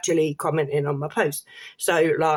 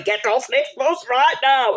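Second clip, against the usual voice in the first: higher, changed voice barking an order; mock conductor order; 5 semitones higher than usual. Mocking a no-mark conductor ordering a fare dodger off the bus. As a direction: play a self-made ticket inspector throwing someone off. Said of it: "just fucking going".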